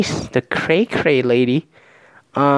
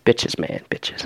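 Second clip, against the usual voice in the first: sighed out; 4 dB quieter, slower than usual. Pretend. Bachelor confiding in the host, sighing weariness. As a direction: say it through a weary sigh, trailing softer. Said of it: "sigh really loud".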